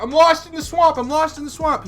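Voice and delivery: high, panicked yelp; imitating a panicked friend lost in a swamp; freaking out. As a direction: squeal it like a lost scared kid. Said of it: "He's freaking out".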